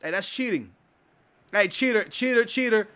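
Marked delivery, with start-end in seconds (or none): none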